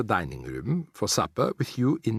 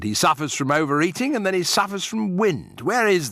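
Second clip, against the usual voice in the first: blustering father; 8 semitones higher, 4 dB louder than usual. A narrator's reading. Mr Jenkins blustering at grandmother.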